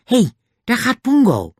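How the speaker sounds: high, loud, excited yell; shouting. A narrator's reading. Julian follows the fight in the cave, shouting. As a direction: keep yelling urgently over the scuffle. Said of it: "schreeuwde hij".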